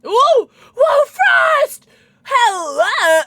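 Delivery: high, loud cheer of surprise; excited shout. Real, not acted. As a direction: yell it out in sheer delight. Excited reaction during the game.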